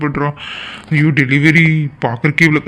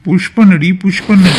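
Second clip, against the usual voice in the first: louder, higher push command; urging shout; 5 semitones higher, 6 dB louder, slower than usual. Acted. Husband urging his wife in labor, loud and high. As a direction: urge her on, loudly and higher.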